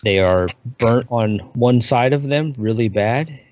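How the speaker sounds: ordinary talk, nothing marked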